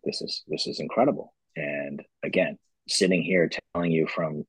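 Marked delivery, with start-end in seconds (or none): 0.0-1.3 breathless, higher whispered disbelief; awed whisper. Retold or imitated. Founder retelling the Whole Foods expansion, awed whisper. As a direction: half-whisper it, stunned by the news. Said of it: "I was like".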